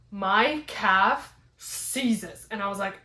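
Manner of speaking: ordinary talk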